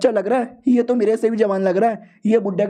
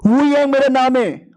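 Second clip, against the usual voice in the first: higher, louder shout of his own name; 6 semitones higher, 4 dB louder than usual. Acted. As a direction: yell it right into his ear. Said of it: "जोर से चिल्लाता है". (कान के पास ज़ोर से चिल्लाना)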